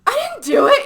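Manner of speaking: high, loud retold denial; mock protest. Carter, accused of writing the card, high mock protest.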